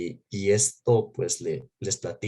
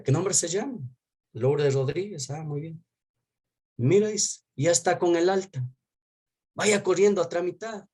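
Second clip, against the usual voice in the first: guard's voice, much higher and bright with good news; hospital guard; 7 semitones higher than usual. Retold or imitated. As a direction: play the guard cheerfully waving him through, high. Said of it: "me dice el guardia". (el guardia, con voz mucho más aguda y animada)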